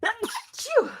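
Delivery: loud, high real sneeze; sneezing. Real, not acted. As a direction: let out a big sneeze. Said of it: "Excuse me".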